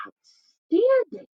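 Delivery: high, slow amazement; awed Gruffalo. Acted. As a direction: stretch the word out in wonder.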